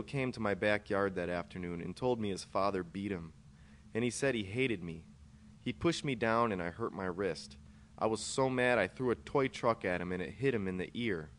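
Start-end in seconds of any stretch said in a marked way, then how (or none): none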